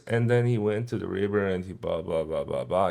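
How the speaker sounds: low, unaccented run-on, trailing down softly; flat monotone. Shown as a demonstration. Guitar teacher showing students soulless talking, flat monotone. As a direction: drone it without punctuation or emphasis. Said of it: "Instead of just talking like this".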